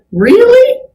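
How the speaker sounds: sharp high incredulous rise; incredulous really. Retold or imitated. Reacting to rude women.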